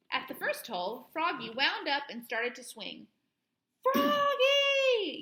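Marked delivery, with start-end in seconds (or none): 3.8-5.2 loud, high alarmed shout of a name; yell. A narrator's reading. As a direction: stop him with a shout. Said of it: "yelled his dad".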